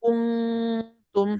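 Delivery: কুনতুম with a long nasal hum for the n; slow, drawn-out nasal কুনতুম; ghunnah. Shown as a demonstration. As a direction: hold the ng in কুনতুম before tum. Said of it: "গুণনাও করতে হবে।".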